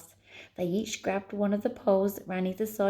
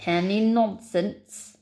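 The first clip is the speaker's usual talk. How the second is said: loud, slow Scottish threat; Scottish accent; 5 dB louder, much slower than usual. Acted. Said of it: "a Scottish accent".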